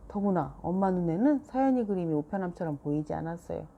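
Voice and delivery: plain reading voice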